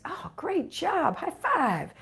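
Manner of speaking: high, drawn-out gushing praise; over-the-top proud parent cheering; lavish praise. Pretend. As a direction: cheer on a small child. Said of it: "give 'em lots of praise".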